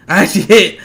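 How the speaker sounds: normal talking voice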